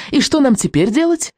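put-on high-pitched boy's cry; called out. A narrator's reading. Boy asks the hidden witch what now, crying out. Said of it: "крикнул Икинг".

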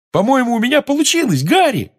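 high, loud excited shout; excited yell. Acted. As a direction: shout it out in triumph. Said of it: "завопил Симус".

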